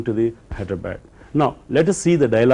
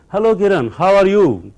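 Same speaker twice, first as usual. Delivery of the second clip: cheerful greeting; 7 semitones higher, 6 dB louder than usual. Acted. Friend greeting friend at bus stop.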